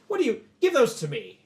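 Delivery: loud, high, impatient order; barked demand. Acted. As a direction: demand them back like a superior officer. General demands the weapons back.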